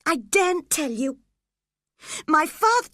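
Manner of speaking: put-on frightened boy gasping; gasped. A narrator's reading. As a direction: cut yourself off in panic. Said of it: "the boy gasped".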